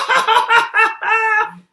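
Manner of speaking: high, loud gleeful goblin cackle. Acted. Goblin laughs at Lily's wrong guess, loud high cackle.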